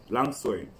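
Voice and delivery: usual speaking voice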